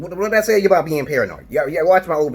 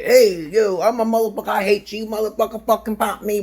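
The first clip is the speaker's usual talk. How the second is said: a lightly voiced brash dare, higher; mock taunting thug; 8 semitones higher than usual. Mocking a careless person daring covid, a mild brash taunt. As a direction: toss off a cocky taunt. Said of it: "be nonchalant about it and say".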